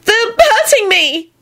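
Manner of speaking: very high, drawn-out complaint; pathetic shriek. A narrator's reading. Elizabeth to Neville in the scrum, put-on pathetic wail. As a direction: wail it slowly for sympathy. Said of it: "a pathetic-sounding voice".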